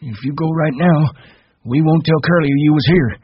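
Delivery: put-on high-pitched old man, insistent; high, pressing old ranch hand; Candy, urging.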